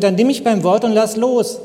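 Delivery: ordinary talk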